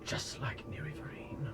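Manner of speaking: soft unvoiced murmur; whispering. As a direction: whisper it over the sleeper. NPC murmuring over sleepers.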